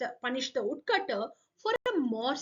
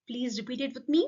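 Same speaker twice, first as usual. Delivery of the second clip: ordinary delivery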